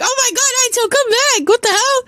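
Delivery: exasperated high holler at no great volume; calling out. A narrator's reading. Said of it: "She yells at him".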